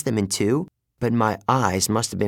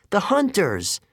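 high excited exclamation; cried out; 9 semitones higher than usual. Acted. Annabeth spotting the archers, a high excited cry. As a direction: call out the name in sudden recognition. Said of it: "Annabeth cried".

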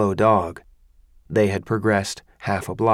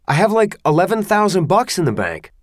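desperate pleading; 8 semitones higher, 4 dB louder, faster than usual. Acted.

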